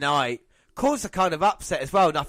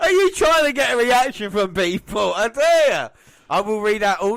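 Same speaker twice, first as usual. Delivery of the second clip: laughing shout; 7 semitones higher, 7 dB louder than usual. Real, not acted. Teasing co-host's cheeky answer.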